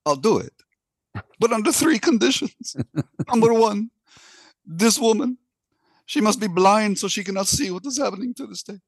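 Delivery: the Pope wailing his refusal in a put-on accent; mock accent Pope. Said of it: "I'm gonna do an accent too".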